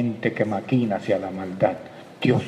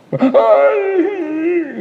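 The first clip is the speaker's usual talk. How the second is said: very high, loud wailing ay; mock tearful cry; 7 dB louder than usual. Preacher mimicking a tearful reunion, high wailing ay. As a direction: let out a sobbing ay.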